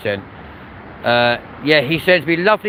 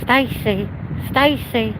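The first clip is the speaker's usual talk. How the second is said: high calling Stacy; 8 semitones higher, 3 dB quieter, slower than usual. Retold or imitated. Calling name like Gavin and Stacey.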